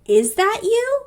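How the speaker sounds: high, hesitant questioning, with a pause; mock disbelief. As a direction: imitate an unsure father, voice lifting high. Father barely recognizes changed daughter.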